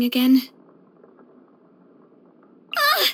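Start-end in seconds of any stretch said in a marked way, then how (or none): 2.8-3.1 short, high yelp of fright; startled yelp. Acted. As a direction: yelp as if thunder just cracked. Startled by thunder.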